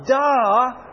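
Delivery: put-on loud kid-style duh, then slow puzzled; mock God as an exasperated kid; God's duh.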